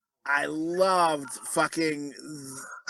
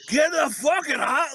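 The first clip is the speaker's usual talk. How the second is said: slow, higher imitation of the dad; dad's voice; 9 semitones higher, slower than usual. Friend imitates the dad he loved, slow higher imitation. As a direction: do the dad's gruff grumble, slowly. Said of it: "I can hear him going".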